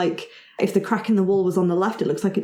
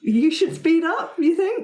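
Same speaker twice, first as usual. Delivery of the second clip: laughing; 10 semitones higher than usual. Real, not acted. GM teasing, laughing.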